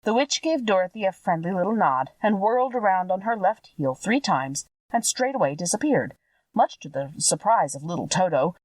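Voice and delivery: usual speaking voice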